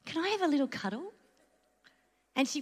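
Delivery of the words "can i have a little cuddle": put-on higher, soft and light, asking sweetly to hold the baby; a kind older woman offering a cuddle with a smile; smiling gentle offer. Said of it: "with a big smile on her face and she says".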